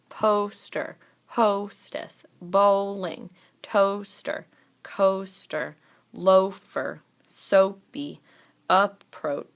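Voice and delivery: first syllable punched loud in poster, hostess, bowling; emphasized long O syllable. Shown as a demonstration. Teacher checking the first syllable, first syllable of each word emphasized. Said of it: "really gonna emphasize the syllable".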